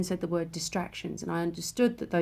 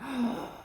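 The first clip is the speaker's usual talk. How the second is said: re-enacted breathy gasp of awe; awed gasp; 5 semitones higher, 5 dB quieter than usual. Speaker recalls freezing at a sacred sight, awed gasp. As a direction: gasp as if frozen on the spot. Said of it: "I froze and went".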